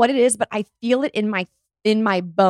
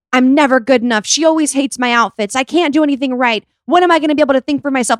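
louder, higher exasperated complaint; inner outburst; 5 semitones higher, 5 dB louder than usual. Retold or imitated. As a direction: act out a wounded inner rant, speeding up at the end. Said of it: "You're thinking in your head".

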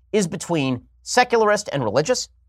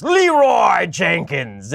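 long drawn-out, mock-heroic shout; hammed-up yell, very slow and raised; Leroy Jenkins battle cry; 3 semitones higher, 4 dB louder, much slower than usual. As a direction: charge in yelling it, stretching the first name out. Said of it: "runs in randomly and shouts".

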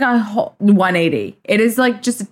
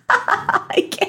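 breathless laughing whisper; 5 dB quieter than usual. Real, not acted. Sister cracking up at the insult, laughing whisper. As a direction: wheeze it out through laughter.

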